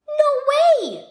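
put-on child blurting out her amazement; squealed "No way!" of shock; astonished outburst. A narrator's reading. Child refuses to believe it.

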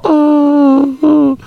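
high squeal while falling; falling cry. Retold or imitated. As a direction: yelp like someone toppling off. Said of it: "You fall".

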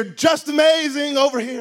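loud call across the water; guide calling out. Retold or imitated. Snorkel guide calling tourists over, loud. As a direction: hail the swimmers.

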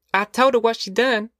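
put-on high, tattling little boy voice; a small boy gloating over his sister; smug little boy. Boy Winfeld voiced in story.

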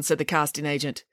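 usual speaking voice

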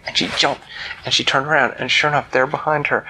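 plain reading voice

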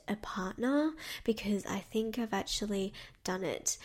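hushed secretive whisper. Retold or imitated. Secret confession in quoted question.

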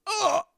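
loud high disgusted groan; disgusted ugh. Real, not acted.

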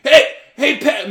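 high, loud imitation of a nagging teacher; yelling. Teacher calling out a kid in class, yelled nagging. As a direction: holler it at a kid across the room. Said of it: "you all yell at me every day".

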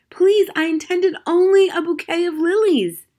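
high, loud desperate shout; shouted plea. Acted. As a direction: cry out to be believed. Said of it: "shouted the magician".

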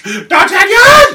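high, drawn-out shout of D'Artagnan; hammed-up villain calling D'Artagnan; villain yell. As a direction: bellow the name slowly, rising. Imitating villain calling name.

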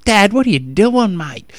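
imitating a daughter's broad Aussie twang, pitched high and loud, dropping on the last word; broad Australian accent. Dad imitating his daughter talking to him, broad Australian accent. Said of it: "real typical Australian accent".

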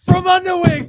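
shouted high pitchman slogan; loud ad slogan. Pretend. Host shouting the fake wig brand slogan, loud and high. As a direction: yell the slogan like a TV ad. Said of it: "like the My Pillow guy".

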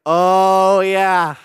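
loud sheepish realization; sudden realization. Retold or imitated. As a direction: catch yourself remembering you already prayed. Sheepish realization of prior prayer.

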